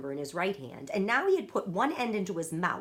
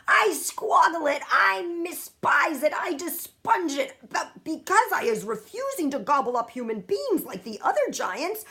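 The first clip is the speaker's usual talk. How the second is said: higher, louder giant full of loathing; disgusted giant; 7 semitones higher, 6 dB louder than usual. A narrator's reading. Giant ranting to Sophie about snozcumbers, loud and disgusted. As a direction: spit out the hated words.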